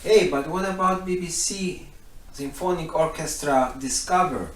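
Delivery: ordinary talk, nothing marked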